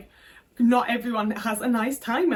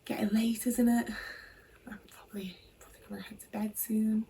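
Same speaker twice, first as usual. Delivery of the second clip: whispered, offhand hint from mum; mother whispering that it's late; mum's whisper; 6 dB quieter than usual. Retold or imitated. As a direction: breathe it out like a hint to go to bed. Mum in dressing gown, hinting.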